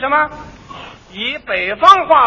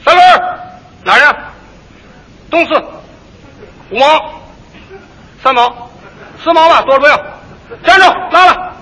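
loud, quick Beijing rickshaw bargaining; Beijing street talk; 2 semitones higher, 4 dB louder, faster than usual. Retold or imitated. Hailing a Beijing pedicab, brisk Beijing accent. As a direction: fire off the Beijing fare haggling. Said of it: "你听听北京话".

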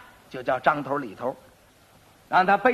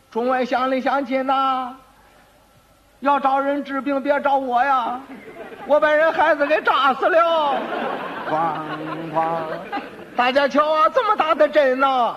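street hawking call; 6 semitones higher than usual. Retold or imitated. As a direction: cry it out to the whole street, half comic. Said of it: "还得吆喝". (高声吆喝游街)

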